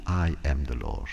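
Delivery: usual speaking voice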